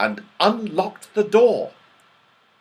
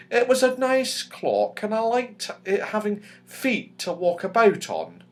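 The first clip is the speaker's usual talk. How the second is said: put-on higher, wistful girl's voice; a fond little fairy missing her clock; Silky, fairy girl; 9 semitones higher than usual. A narrator's reading. Silky misses her clock, high and wistful.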